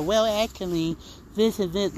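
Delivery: lightly mock a know-it-all correcting you; the nerdy Well Actually guy, mock pedantic. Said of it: "But, like, a".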